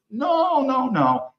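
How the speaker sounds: acted-out refusal, pitched well up; high, drawn-out string of noes; mock protesting refusal. Refusing a demand to send military, in mock protest.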